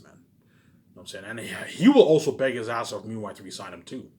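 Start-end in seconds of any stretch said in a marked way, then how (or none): none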